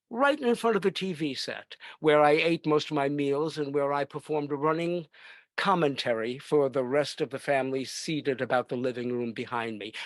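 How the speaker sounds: normal talking voice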